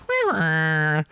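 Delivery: a high, silly mock sound effect of a ship going down; imitated sinking-ship noise. Friend mimics the game's sinking sound for a friend, high and silly. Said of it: "like, that's the sound".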